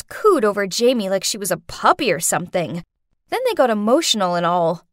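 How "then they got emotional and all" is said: ordinary delivery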